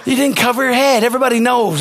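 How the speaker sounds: ordinary talk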